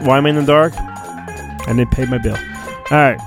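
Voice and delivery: normal talking voice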